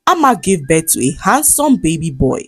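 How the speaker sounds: usual speaking voice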